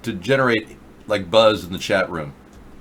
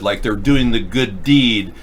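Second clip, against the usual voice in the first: ordinary talk, nothing marked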